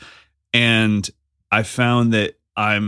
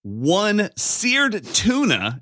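high, stretched-out put-on presenter voice; exaggerated broadcast delivery slowing right down; hammed-up podcast voice; 8 semitones higher, slower than usual. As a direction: order food like a show host, overdoing it. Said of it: "Why are you using your podcast voice?".

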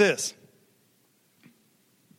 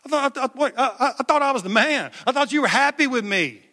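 much higher, flustered, stammering protest; Jesus protesting; 6 semitones higher than usual. Retold or imitated. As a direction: play a confused, hurt Jesus, half laughing. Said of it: "Jesus had to be like".

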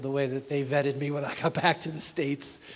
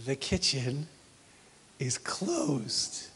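softer, whispered verdict; hushed final; 6 dB quieter, much slower than usual. Retold or imitated. Mom ending the night with a firm hushed announcement. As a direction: close the kitchen in a hush.

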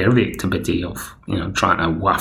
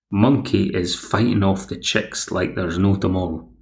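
ordinary delivery

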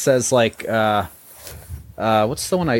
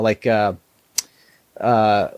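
ordinary talk, nothing marked

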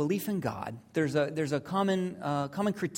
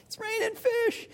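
very high, squealing glee; gleeful fishermen. Acted. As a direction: squeal it like giddy fishermen with a huge haul. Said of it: "they're just giggling. I mean, it, to them, it's payday".

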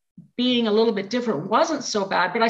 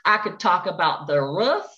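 Southern accent. Shown as a demonstration. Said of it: "I could just turn it on like this".

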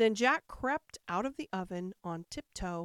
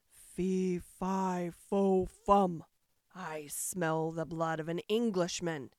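ogre's cry; 2 semitones lower, slower than usual. A narrator's reading. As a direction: boom the chant out like a giant sniffing prey. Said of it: "cried out the ogre".

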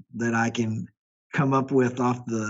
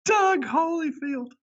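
high drawn-out; 14 semitones higher, slower than usual. Pretend.

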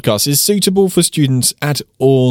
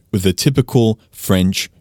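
ordinary talk, nothing marked

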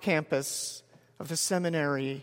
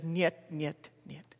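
tired and soft, the last niet fading almost to nothing; weary soft refusal, dying away; exhausted; 7 dB quieter, slower than usual. Retold or imitated. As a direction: say niet as if too worn out to go on. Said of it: "in this very exhausted voice".

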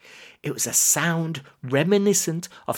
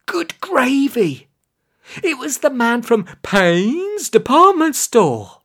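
high, loud amazement; dramatic, raised-pitch cliffhanger cry; astonished reveal; 10 semitones higher, 5 dB louder than usual. A narrator's reading. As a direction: exclaim it as a shocking discovery. Astonished reveal of man leading army.

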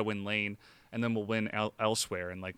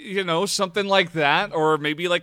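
slick high pitched sales pitch, louder; used car salesman; 7 semitones higher, 7 dB louder than usual. Acted. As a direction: hype it up like a car dealer. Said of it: "you sound like a used car salesman right now".

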